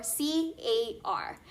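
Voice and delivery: letter by letter, slow and deliberate; spelled out slowly. Acted. Spelling out acronym.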